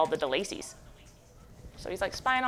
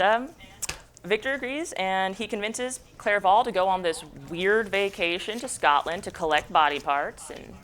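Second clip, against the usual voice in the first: usual speaking voice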